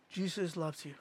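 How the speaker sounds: very soft and low; gentle reassurance. Retold or imitated. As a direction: let it drop to a tender hush. Retold reassurance to hurting woman.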